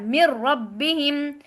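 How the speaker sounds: मिर रब्बिहिम with the नून merged into a doubled र्र, slow; मिर रब्बिहिम, slowly, doubling र्र with force; मिर रब्बिहिम, नून fully assimilated and stressed. Shown as a demonstration. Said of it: "शिद्दत के साथ पढ़ा है".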